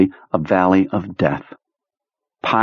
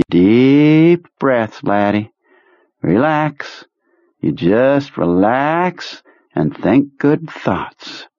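high, slow, soothing commander; soothing Kurtz voice; 5 semitones higher, much slower than usual. Acted. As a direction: coax him to relax, slowly.

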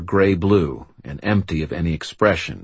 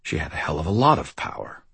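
ordinary delivery

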